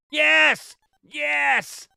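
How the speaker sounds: high, loud triumphant shouts; jubilant yes. Real, not acted. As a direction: whoop at the win. Success in the game.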